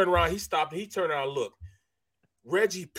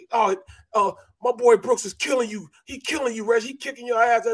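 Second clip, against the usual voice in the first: higher-pitched retold taunting; goading trash talk, retold in a raised pitch; mock trash talk; 7 semitones higher than usual. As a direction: imitate a teammate cussing out his opponent. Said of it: "he started cussing Reggie".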